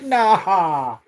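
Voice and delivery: high laughing scoffing no; laughing dismissal. Retold or imitated. Mocking ghost show claims with a laughing no. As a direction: laugh it off as nonsense. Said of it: "I'm like".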